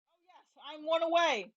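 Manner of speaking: ordinary talk, nothing marked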